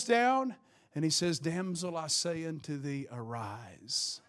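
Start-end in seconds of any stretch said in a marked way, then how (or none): 1.4-4.2 low, soft, solemn voice; hushed command. Acted. Jesus kneeling by the dead girl, hushed solemn command. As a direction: speak tenderly but with quiet authority.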